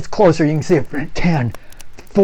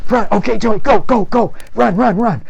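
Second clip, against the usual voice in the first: louder, higher shouted urging; urgent yell; 8 semitones higher, 4 dB louder than usual. Acted. Scoutmaster yelling for Joey to run. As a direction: push him to run faster.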